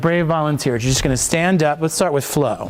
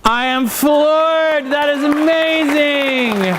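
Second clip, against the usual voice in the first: excited gushing praise; 9 semitones higher, much slower than usual. Real, not acted. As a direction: gush with amazement, slowly and high. Coach gushing after first read.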